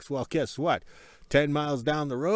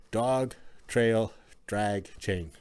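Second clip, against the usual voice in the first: tipsy careful speech; much slower than usual. Real, not acted. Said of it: "I had to say that sober".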